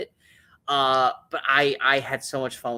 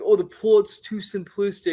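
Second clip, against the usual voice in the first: higher, louder mocking quote; mocking critics; 6 semitones higher, 6 dB louder than usual. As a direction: parody a nitpicker, pitched up and louder. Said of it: "I love people like".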